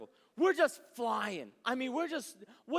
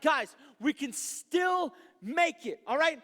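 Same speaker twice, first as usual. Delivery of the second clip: slow, loud rallying shout; shouting to the group; 4 semitones higher, 6 dB louder, slower than usual. Retold or imitated. Telling the mission team behind him to hurry, loud shout. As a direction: call it out down the rows. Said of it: "shouting this stuff out".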